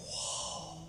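soft, drawn-out whoa of wonder; quiet amazed exclamation; hushed awe. Acted. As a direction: breathe it out softly, astonished. Acted awed reaction of the king.

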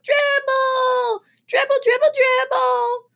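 put-on high chanting shout in a little kid voice; a bunch of small children chanting along. A narrator's reading. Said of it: "they started to shout".

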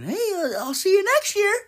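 a corny guy shouting his joke goodbye; goofy high voice. Acted. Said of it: "you get to say fucking".

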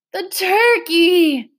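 high, slow, near-sobbing wail; drawn-out tearful lament; almost sobbing. A narrator's reading. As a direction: cry it out, nearly in tears. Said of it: "Trotter was almost sobbing now".

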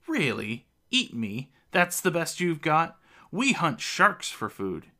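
scoff, pretending not to be frightened; scoffing bravado. A narrator's reading. Merman with an axe at his throat, scoffing bravado. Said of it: "He laughs, pretending not to be frightened".

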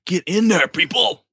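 high-pitched holler building to a shriek; hammed-up yell to rally the players; rousing shout. Game master acts rousing the players.

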